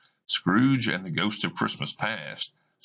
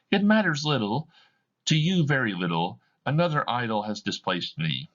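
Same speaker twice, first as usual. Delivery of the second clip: higher, bitter and hurt; mild tearful reproach to her former love; tearful hostility; 7 semitones higher than usual. Acted. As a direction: reproach him through tears. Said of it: "She is crying. She speaks to the man with hostility".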